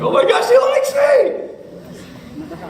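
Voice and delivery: high, slow squealing shout of delight; thrilled shout. Retold or imitated. Middle schooler reads a girl's note, high thrilled shout. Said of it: "and you're like".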